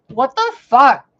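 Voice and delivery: drawn-out loud what the fuck; loud slow shock. Real, not acted. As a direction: exclaim at the sharp teeth. Shocked at sharp teeth.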